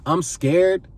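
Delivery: ordinary talk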